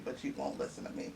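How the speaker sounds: soft, breathy muttered aside; teasing aside under the breath; under her breath. Real, not acted. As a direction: mutter it half to yourself. Teasing aside after matchmaking remark.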